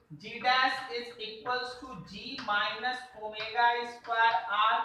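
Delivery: very slow, word by word; slow motion. Shown as a demonstration. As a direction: read the formula out at a crawl. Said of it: "देखो slow motion में बोलेंगे".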